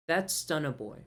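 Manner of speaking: ordinary talk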